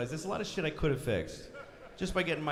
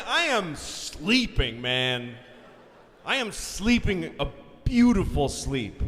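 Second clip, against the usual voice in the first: dreamy rhapsody; 4 dB louder, slower than usual. Acted. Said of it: "hammed-up sleep rhapsody".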